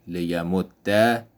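لیمد said "liyamudda", doubled d then a; لیمد with final fatha, "li-ya-mud-da"; Arabic ending with fatha. Shown as a demonstration. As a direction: end لیمد on a clear open a. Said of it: "ادغام با حرکت فتحه".

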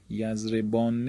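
ordinary talk

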